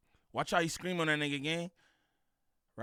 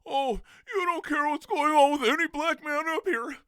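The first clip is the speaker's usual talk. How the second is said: high, louder whiny mock voice of the critic; mocking replay; 9 semitones higher, 6 dB louder than usual. Host mocking the guest's accusation in a high whiny voice. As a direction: parody his line as a whiny higher-pitched complaint. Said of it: "I'm gonna run that back".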